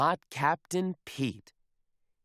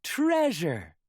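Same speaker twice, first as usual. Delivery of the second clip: louder excited shout; excited cry; 5 semitones higher, 4 dB louder than usual. Acted. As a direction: call out the prize gleefully. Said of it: "cries the crew".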